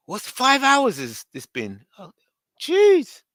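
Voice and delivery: ordinary delivery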